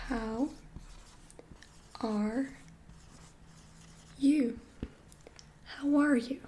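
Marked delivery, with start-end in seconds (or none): 0.1-4.6 "How", "are", "you" with long pauses between; "How are you" broken into separate words. Shown as a demonstration. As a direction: space out each word slowly for the learner.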